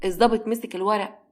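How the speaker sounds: usual speaking voice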